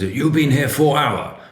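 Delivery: high, drawn-out mock Chinese accent. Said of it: "laughing at the funny foreign accent".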